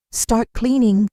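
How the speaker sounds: put-on the aunt orders the girl to start cleaning; ordering aunt. A narrator's reading. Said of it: "she ordered".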